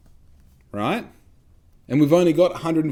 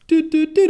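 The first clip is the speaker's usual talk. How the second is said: high, loud sung doot doot doot; imitated Kim Possible ringtone; 8 dB louder than usual. He realises his mistake, singing the Kim Possible chime high. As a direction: sing the gadget chime high and bright. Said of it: "you hear the little Kim Possible".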